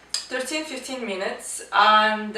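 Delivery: usual speaking voice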